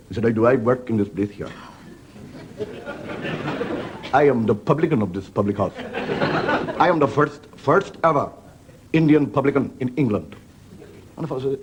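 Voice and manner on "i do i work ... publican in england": put-on Indian accent. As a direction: announce it with pride in an Indian accent. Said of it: "is an Indian".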